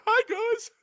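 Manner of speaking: very high squeaky voice crack, laughing; mock cracking voice. Player joking about their own voice cracking, high mock squeak. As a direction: squeak it out like a breaking voice. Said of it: "so my voice is, like, cracking".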